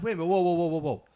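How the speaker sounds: a retold double take, stopping someone short; startled whoa. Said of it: "I'm like".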